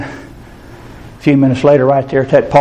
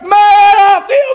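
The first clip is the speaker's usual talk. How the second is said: very high, loud, slow cries of joy; a healed man shouting that he feels good; ecstatic joyful shout; 3 dB louder, much slower than usual. Retold or imitated. Overjoyed man crying out to the preacher in the park, high ecstatic shout.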